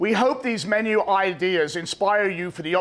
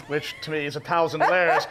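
ordinary talk, nothing marked

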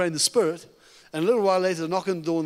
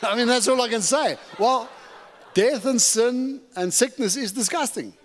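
preaching shout; 5 semitones higher than usual. Real, not acted. Preaching against sickness, shouted.

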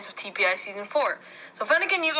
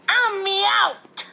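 much higher, squeaky cat toy voice; high, squeaky Meowth voice; 8 semitones higher, 3 dB louder than usual. Pretend. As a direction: pitch up like a little cartoon cat.

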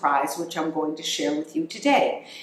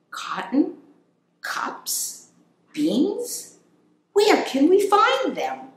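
much higher, questioning little-critter voice; puzzled hamster voice; 13 semitones higher, slower than usual. Acted. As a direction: puzzle over each item like a tiny hamster. Hamster reads list, puzzled.

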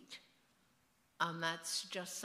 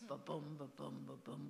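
Ba-boom with boom stressed, softer; iambic beat; 3 semitones lower, 5 dB quieter than usual. Shown as a demonstration. Author demonstrating English rhythm, soft heartbeat stress. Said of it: "by the rhythms".

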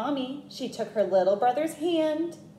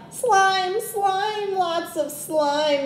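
high mock yell of disgust; 8 semitones higher, much slower than usual. A narrator's reading. Little brother yells again about snail slime. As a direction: squeal it, grossed out. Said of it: "yelled Tate".

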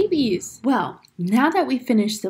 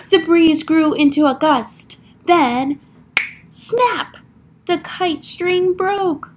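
suspenseful build to a high snap; dramatic picture-book narration; 3 semitones higher, 5 dB louder, slower than usual. A narrator's reading. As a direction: build the storm for young listeners. Reads picture book aloud.

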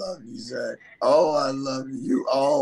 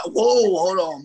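startled whoa; 5 semitones higher, 5 dB louder than usual. Retold or imitated. Boat nearly sinking with fish.

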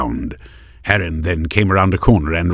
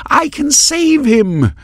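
high, slow, desperate scream; 6 dB louder, slower than usual. A narrator's reading. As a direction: Maris screams at Heron to let her pass. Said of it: "she screamed".

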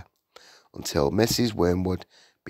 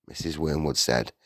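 plain reading voice

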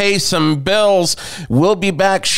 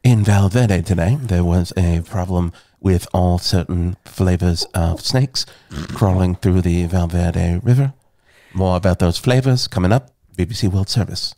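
lower, measured British BBC news read; British newsreader accent; 12 semitones lower than usual. Acted. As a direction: read it like a BBC World Service announcer. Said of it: "That is such a good British accent".

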